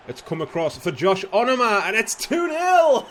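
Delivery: screaming goal call; excited goal shout. Real, not acted. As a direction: roar the second goal. Second goal scored.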